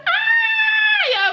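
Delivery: very high, loud laughing squeal; delighted squeal. Real, not acted. Big sister hears little sister say it, delighted squeal.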